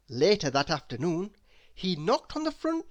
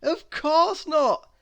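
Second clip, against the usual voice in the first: much higher, louder, slow; playful matter-of-fact; amused; 12 semitones higher, 9 dB louder, slower than usual. A narrator's reading. Mister Happy answers Miss Giggles, amused.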